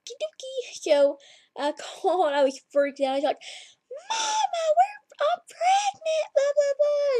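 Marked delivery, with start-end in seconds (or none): 0.1-0.7 squeaky high, hushed okie dokie; mock cheery okie dokie. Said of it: "and I was like". 4.1-7.2 crying shout, very high and breaking; bawling to mama. Retold or imitated. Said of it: "I was bawling".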